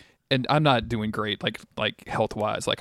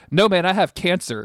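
blurted out; 7 semitones higher, 5 dB louder than usual. Retold or imitated. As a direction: blurt it out, louder and higher. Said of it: "I was just like".

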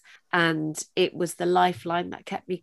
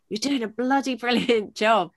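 laughing encouragement; 6 semitones higher, 4 dB louder than usual. Retold or imitated. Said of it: "go out and say".

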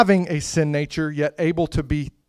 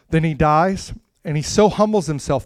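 ordinary talk, nothing marked

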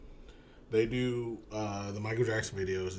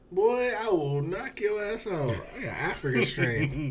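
louder, slow, higher threat; mock tough father; 8 semitones higher, 5 dB louder, slower than usual. Imitating the father threatening the prince, mock tough. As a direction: threaten like a swaggering dad.

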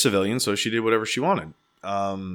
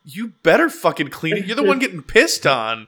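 much higher and louder, last line slow; exasperated scolding; 9 semitones higher, 6 dB louder than usual. Retold or imitated. Roommate scolding the wife that she asked for it, high and loud. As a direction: scold her, stretching out your request.

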